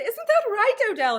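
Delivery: high, loud and sugary imitation of a wife; mock wife voice. Voicing the wife in the story.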